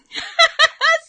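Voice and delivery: high, bursting laugh; a big squealing laugh; loud delighted laugh. Real, not acted. Delighted by a viewer's comment.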